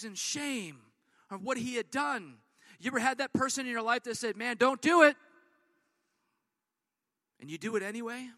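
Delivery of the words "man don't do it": loud pleading warning; warning shout. Acted. Friend loudly warning you not to do it. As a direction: call it out like stopping a friend.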